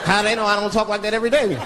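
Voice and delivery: ordinary talk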